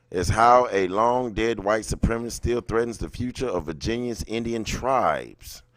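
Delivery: ordinary talk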